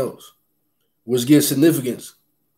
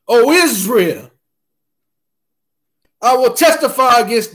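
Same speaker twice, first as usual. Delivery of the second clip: preaching at full force; 5 semitones higher than usual. Acted. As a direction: proclaim it like God speaking. Keeps preaching psalm forcefully.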